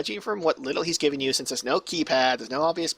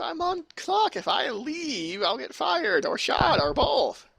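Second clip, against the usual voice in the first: much higher, drawn-out pleading voice; flustered assistant, pleading; 13 semitones higher, slower than usual. Acted. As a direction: plead like a frightened underling.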